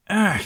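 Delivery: sharp high disgusted groan; a loud grossed-out ugh. A narrator's reading. Licked on the cheek.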